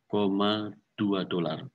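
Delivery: ordinary delivery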